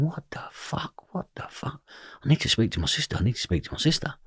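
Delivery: whispered muttering to oneself; hushed, breath-only self-talk; dazed whisper. Retold or imitated. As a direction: whisper it in stunned disbelief. Muttering to self walking home, dazed.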